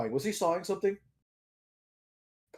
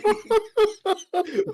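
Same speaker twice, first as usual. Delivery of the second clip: high-pitched laugh; 4 dB louder than usual. Real, not acted. High laugh between the words.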